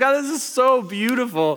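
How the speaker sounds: high, hammed-up weepy gushing; mock tearful gushing. Viewer gushing over a beautiful TV moment, mock tearful. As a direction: pretend to be moved to tears. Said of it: "sitting there crying".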